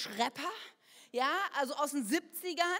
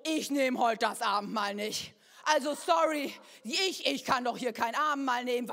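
louder, stubborn refusal; loud protest; 2 semitones lower, 8 dB louder than usual. Retold or imitated. Churchgoer refusing communion from the ushers, loud.

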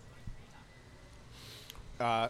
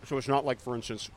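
talking through a mouthful of pizza; chewing while speaking; mouth full; 4 semitones lower, 3 dB quieter than usual. Real, not acted. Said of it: "talking with your mouth full".

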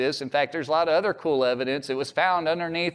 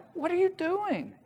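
exasperated sailors; 14 semitones higher, 4 dB quieter than usual. Retold or imitated. Sailors angry at sleeping Jonah.

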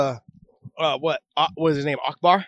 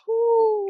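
pained woo. Real, not acted. Burning mouth from hot chip.

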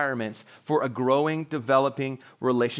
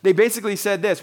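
ordinary talk

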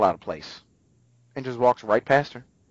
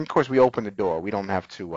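normal talking voice

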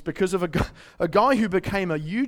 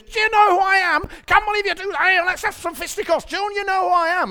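put-on much higher, louder, northern accent; hammed-up northern accent; Ronnie Pickering northern accent; 13 semitones higher, 7 dB louder than usual. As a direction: rant like a northern hothead in a road rage. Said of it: "only got one northern accent in my toolkit".

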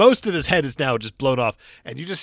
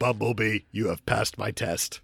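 robot voice; 5 semitones lower, 6 dB quieter than usual. Pretend. Voicing a Transformer character.

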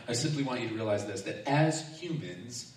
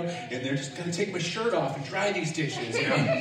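usual speaking voice

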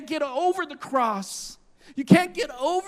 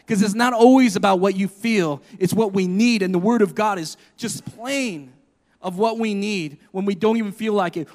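usual speaking voice

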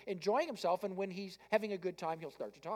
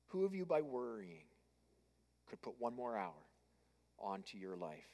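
soft restatement; 8 semitones lower, 10 dB quieter than usual. Shown as a demonstration. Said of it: "Jesus said that".

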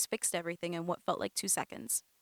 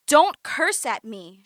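loud, slow, stung scream; 2 semitones higher, 11 dB louder, slower than usual. A narrator's reading. Cousin screams at Amanda for cursing, offended. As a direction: yell it, offended. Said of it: "she screamed".

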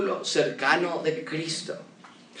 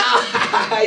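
mocking laugh; 5 semitones higher, 4 dB louder than usual. Mourners laughing at Jesus.

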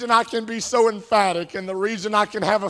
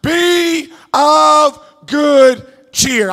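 Be of good cheer stretched very slow and louder; slow, drawn-out repeat; 6 semitones higher, 6 dB louder, much slower than usual. Shown as a demonstration. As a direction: draw out "of" long and deliberate, hold each word. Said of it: "He says it twice".

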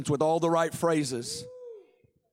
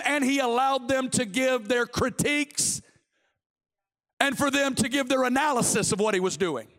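high, ringing sermon delivery; impassioned preaching; 6 semitones higher than usual. Real, not acted. Preacher telling the congregation of God's vulnerability, impassioned. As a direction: preach it with mounting fervour.

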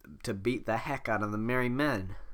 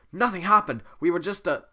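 flustered protest; 7 semitones higher, 7 dB louder than usual. Acted. Shrek flustered.

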